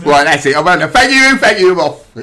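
loud, high Cockney manager rant; Neil Harris impression. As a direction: bellow it like an irate London manager. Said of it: "Who do we think that was?".

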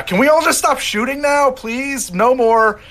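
high, loud yell over noise; top-of-the-lungs battlefield plea. Acted. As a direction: shout it out over the din of combat. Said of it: "trying to yell out as loud as I can".

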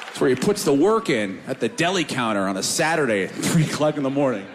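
normal talking voice

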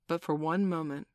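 plain reading voice

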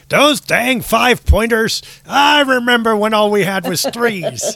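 a hint of a cranky old geezer, laughing; old prospector. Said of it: "You just become an old fuddy-duddy".

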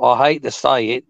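drawn-out mock British-Aussie accent; playfully stretched vowels in a fake accent; put-on accent. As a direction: slip into a silly accent, slowly. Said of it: "I don't know where the accent came from".

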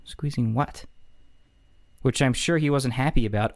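normal talking voice

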